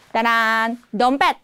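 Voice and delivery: ordinary talk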